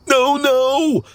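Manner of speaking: very high, alarmed cry; panicked exclamation. A narrator's reading. Clumsy faces the hissing cat, exclaiming in panic. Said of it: "Clumsy exclaimed".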